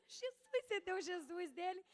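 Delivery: laughing. Real, not acted. Laughing at the friend's Jesus.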